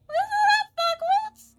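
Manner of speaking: very high, loud, strained squawk; Kaiser's shrill voice. Acted. Streamer showing how the broker talks, very high loud squawk. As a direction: screech it like a nasty little broker. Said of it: "That's how he talks".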